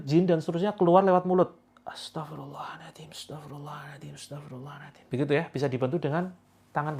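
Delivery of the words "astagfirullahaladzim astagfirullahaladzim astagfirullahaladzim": breathy exhale. Shown as a demonstration. Healer showing patients how to exhale istighfar in a whisper. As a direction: breathe the prayer out through the mouth. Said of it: "Hembuskan lewat mulut".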